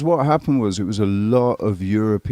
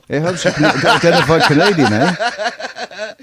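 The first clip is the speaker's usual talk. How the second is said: higher, laughing through the eh; laughing mock Canadian; 5 semitones higher, 4 dB louder than usual. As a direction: tease the Canadian eh while laughing. Joking about Canadian eh.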